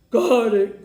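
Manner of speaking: loud satisfied grunt. Acted. Beth Ann snatching the only swing, grunting. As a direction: grab it with a grunt. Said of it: "grunted a very contented".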